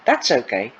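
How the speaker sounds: ordinary delivery, nothing marked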